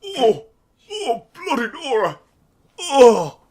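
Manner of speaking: loud startled cry; shocked exclamation. Acted. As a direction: gasp out in shock. Character reacts to killing.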